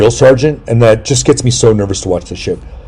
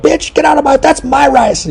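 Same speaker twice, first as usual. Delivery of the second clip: high falsetto reality-show outburst; mock catfight. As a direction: parody a dramatic squabble, pitched way up. Acting reality-show drama fight.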